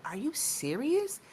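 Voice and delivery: mock-shocked are you serious; incredulous. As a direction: drag it out in disbelief. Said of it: "I'm just like".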